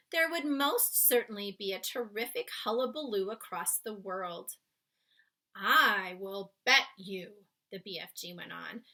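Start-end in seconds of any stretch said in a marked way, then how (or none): 5.5-7.4 slow, louder giant voice. Acted. Giant teasing Sophie, slow and loud. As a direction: lean into it knowingly. BFG voiced in read-aloud.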